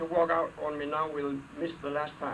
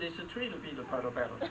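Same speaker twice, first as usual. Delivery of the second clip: fast; 3 semitones lower, 4 dB quieter, faster than usual. Pretend. Tongue twister rattled off.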